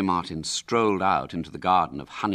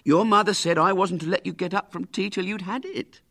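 much higher, louder pleading nurse; insisting nurse; 13 semitones higher, 4 dB louder than usual. Acted. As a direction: appeal to mother's orders.

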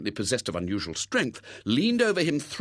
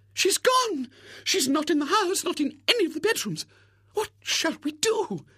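alarmed spinster. Acted. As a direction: panic theatrically like a fussing old maid. Miss Rubina alarmed, mother missing.

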